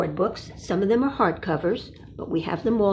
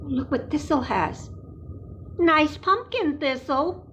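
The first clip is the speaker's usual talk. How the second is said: usual speaking voice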